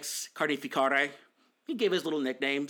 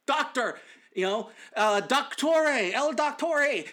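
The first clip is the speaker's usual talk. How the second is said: mock clueless tourist; 8 semitones higher, slower than usual. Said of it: "call doctor".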